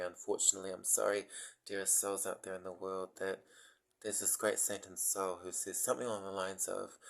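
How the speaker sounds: normal talking voice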